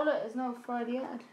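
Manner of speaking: normal talking voice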